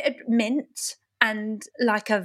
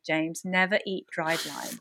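normal talking voice

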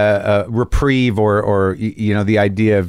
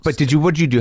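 ordinary talk, nothing marked

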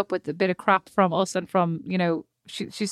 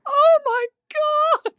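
hammed-up wail, very high and drawn out; slow, high-pitched mock sobbing; heartrending sobs; 4 dB louder, much slower than usual. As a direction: imitate someone racked with sobs. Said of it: "heartrending sobs, like, racked with".

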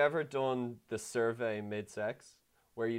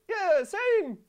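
high, loud, strained mock agreement; faked climax; 8 dB louder than usual. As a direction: squeal along, badly faking it. Retold faking along with partner.

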